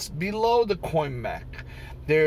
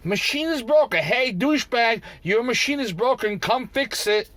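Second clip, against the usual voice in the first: mock message; 6 semitones higher than usual. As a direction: act out the text they should send. Example message he wanted.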